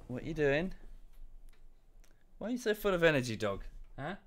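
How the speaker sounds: cooing at an excited puppy; playful talk to the dog. Real, not acted. Streamer talks to his dog.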